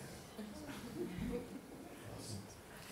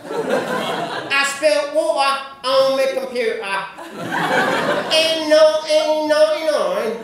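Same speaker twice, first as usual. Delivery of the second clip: slow, high-pitched Cockney with dropped T's; exaggerated Cockney, glottal stops for every T; London Cockney accent; 7 semitones higher, 3 dB louder, slower than usual. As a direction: draw out a broad London Cockney. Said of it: "They don't pronounce T sounds".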